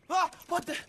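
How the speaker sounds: sudden high, loud yelp of surprise; startled. Acted. Boy startled by a sudden visitor, yelping high. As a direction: jump at something unexpected.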